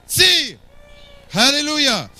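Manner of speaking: high-pitched exultant shouts; crying out. Real, not acted. Preacher affirming that one can cry out in church, exultant shouting. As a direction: fire off each word as a high cry. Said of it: "Il a crié d'une voix forte".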